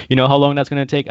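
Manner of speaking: mock cook. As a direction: push back like a harried cook. Acted cook reply.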